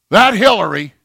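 high, loud exasperated grumble; mock outrage. Voter passing judgment on a politician, mock-outraged. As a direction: spit out the name in hammed-up disgust. Mimics people judging politicians.

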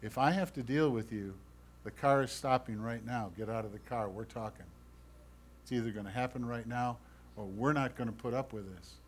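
a quiet warning that means business, fading away at the end; God quietly warning the believer to stop now; hushed God. Acted. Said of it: "And God whispers to you".

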